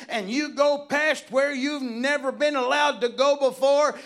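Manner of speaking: loud, high, driving sermon delivery; full-force preaching. Real, not acted. Preacher urging saints past their limitations, fervent preaching. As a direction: keep driving upward in pitch and power.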